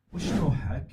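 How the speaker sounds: Najdi Bedouin accent, demo phrase. Shown as a demonstration. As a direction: say it like a northern Bedouin. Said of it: "لو أقول لك،".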